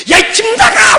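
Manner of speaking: high, loud furious yell; angry parent. Retold or imitated. Angry parent shouting at child to leave, high yell. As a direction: throw the kid out in a rage.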